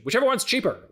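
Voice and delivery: high, loud, dismissive bark; hammed-up impatient cheapskate boss; J. Jonah Jameson. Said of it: "comes out of J K Simmons' mouth".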